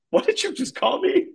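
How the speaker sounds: high-pitched, affronted disbelief; incredulous question. Acted. Little Katie reacting to his new name, incredulous.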